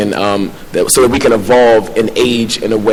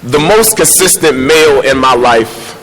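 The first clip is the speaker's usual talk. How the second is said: spoken word poem; 5 semitones higher than usual. Acted. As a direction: perform it like a slam poem. Performs his poem.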